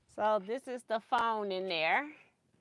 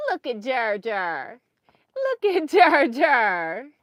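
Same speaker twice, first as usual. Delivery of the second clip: slow cooing to a grandchild; slow sing-song; 8 dB louder, slower than usual. Real, not acted. Urging toddler to look.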